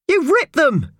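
very high, loud outraged shriek; shrieked accusation. A narrator's reading. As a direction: shriek in horror at the damage. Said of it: "shrieked the woman".

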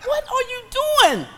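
high, slow cry to Jesus with a laugh; exasperated cry. Retold or imitated. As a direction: throw it up to Jesus, half laughing. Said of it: "I said".

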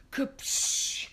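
ordinary talk

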